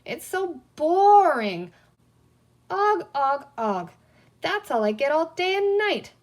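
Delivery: bored whine. A narrator's reading. Green man complains.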